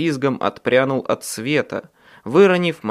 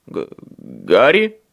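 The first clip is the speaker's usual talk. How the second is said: much lower, slow, stammering question; unsure; 8 semitones lower, 5 dB louder, slower than usual. A narrator's reading. Michael asking Harry what to do, slow unsure stammer. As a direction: falter over the name. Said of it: "неуверенно произнёс он".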